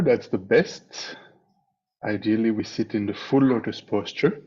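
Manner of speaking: normal talking voice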